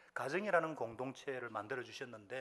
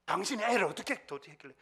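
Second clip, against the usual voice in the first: raised, higher-pitched blame; mock accusing husband; 6 semitones higher, 7 dB louder than usual. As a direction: imitate a husband scolding his wife. Acted husband blaming his wife.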